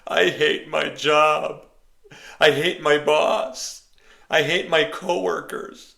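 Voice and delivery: high, loud whining sister; mock-sobbing sister griping about her job; whiny complaining. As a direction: complain like she's near tears. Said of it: "she's crying all the time".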